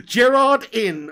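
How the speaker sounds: slow, loud, drawn-out Gerrard in; loud, slow mock outrage; mock disbelieving shout. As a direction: bellow it in disbelief, slowly. Echoing chat, disbelief.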